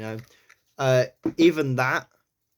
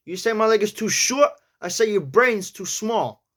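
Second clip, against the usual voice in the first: New York accent; 8 semitones higher, 3 dB louder than usual. Shown as a demonstration. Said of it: "let's carry on with that".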